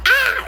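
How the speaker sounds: sudden high startled yelp. Retold or imitated. Tattooer startled by a knock, letting out a high yelp. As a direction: jump out of your skin with a short high cry. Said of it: "what if I'm like".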